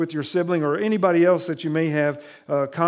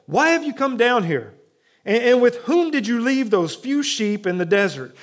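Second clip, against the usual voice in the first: sneering big-brother question, pitched up; condescending; 5 semitones higher, 4 dB louder than usual. Retold or imitated. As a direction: talk down to him with contempt. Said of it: "You know, condescending".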